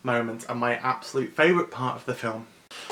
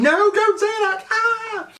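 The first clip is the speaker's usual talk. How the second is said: very high, loud wail of protest; squealing child protest; 7 dB louder, slower than usual. Retold or imitated. A girl defending the pet's name, high squeal. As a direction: shriek like a hurt kid.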